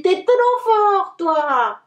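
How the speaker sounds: high, slow and thrilled; little brother amazed at his big brother; delighted. A narrator's reading. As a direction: gush with admiration. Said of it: "Gaspard est trop content".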